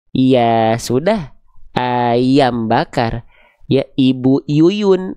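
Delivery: very slow, stretched y glide in "Ya", "Ayam", "Yuyun"; drawn-out ya sound. Shown as a demonstration. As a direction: linger on each y in "Ayam" and "Yuyun". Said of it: "Lafalkan huruf ya gimana?".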